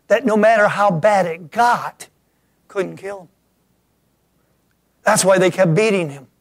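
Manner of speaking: loud, rising sermon delivery; preacher roaring about the beating; preaching at full force. Acted. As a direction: build the fervour, push it out.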